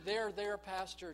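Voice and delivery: put-on the young man soothes the pastor with his own advice; mock soothing. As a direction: hand the pastor's own words back gently.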